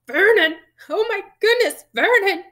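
high, loud panicked wail; horrified cry. A narrator's reading. Aunt has read the letter, crying out in horror. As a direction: cry out to your husband in shock.

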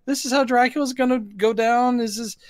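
high, disbelieving rhetorical question; incredulous. Retold or imitated. Reviewer mocking the finale, high disbelief. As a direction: scoff at the ending. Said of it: "And I'm like".

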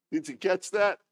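ordinary talk

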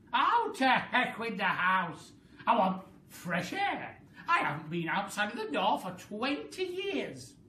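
very high, loud cackling crone voice; a mad old woman crowing in triumph; Grandma crowing as she grows, shrill glee; wild old grandma. A narrator's reading. Grandma character voice.

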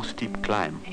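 usual speaking voice

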